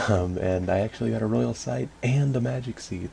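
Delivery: usual speaking voice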